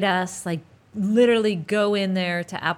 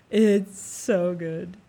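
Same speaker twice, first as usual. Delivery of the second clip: slow gush; 4 dB louder, much slower than usual. Real, not acted. Gushing after fake undersell.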